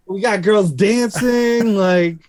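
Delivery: high, laughing, thrilled; excited thought. Retold or imitated. Guitarist recalling girls dancing at their second show, thrilled and high. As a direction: gush it up high, can't believe it.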